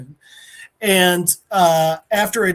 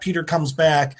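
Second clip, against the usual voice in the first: ordinary talk, nothing marked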